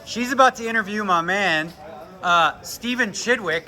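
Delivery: ordinary delivery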